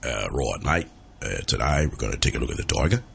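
low-pitched Australian wildlife presenter. Acted. Presenter introduces the tiger, low Australian voice. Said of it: "That's my Australian voice".

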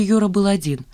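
plain reading voice

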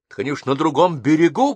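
loud, high exasperated shout; officer yelling that the thieves got away. Acted. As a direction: yell it in frustration. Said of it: "кричал Тараканов".

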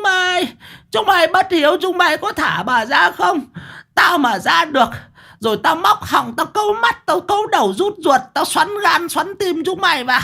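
very high screeched curses; furious high-pitched tirade with long wails; cursing old woman. A narrator's reading. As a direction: screech abuse and wail out the oaths. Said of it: "mồm vẫn chửi rủa những lời tục tĩu".

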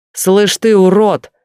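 yelling. Acted. Young man shouting over Lena at her husband, loud. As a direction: bellow it past her at him. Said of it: "кричит через меня Артёму".

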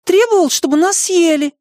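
very high-pitched accusation; outraged small creature; indignant. A narrator's reading. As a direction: accuse your friend in a squeaky voice. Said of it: "возмущённо повернулся к нему Мартын".

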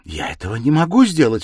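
loud, high, hurt protest; offended cry. A narrator's reading. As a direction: cry out in indignation. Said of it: "вскричала обиженная девушка".

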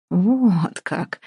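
prosecutor's voice. Acted. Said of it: "прокурорским голосом изрёк мужик".

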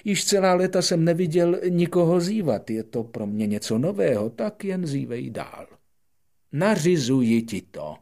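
loud, high royal decree; pompous king. Acted. King urging the prince to keep yawning, loud and high. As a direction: proclaim like a vain monarch.

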